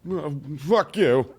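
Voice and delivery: slow, sulky mock snap; bitter comeback. Mocking a rejected guy answering a woman, in a slow sulky snap. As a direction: spit it back like a rejected guy. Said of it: "And you're like".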